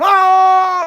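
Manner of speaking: high, loud mock shout of searchers in the woods; mock yell. Vlogger imitating Bigfoot hunters yelling in woods, loud mock shout. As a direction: yell like a show host spooked in the woods. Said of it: "they're going".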